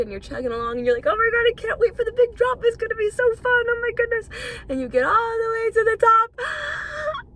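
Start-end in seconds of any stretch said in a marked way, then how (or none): 1.1-7.3 much higher and louder, thrilled coaster rider; excited rider. Retold or imitated. Roller coaster rider waiting for the drop, high thrilled gushing. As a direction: gush like you're climbing to the big drop. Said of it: "and you're so excited".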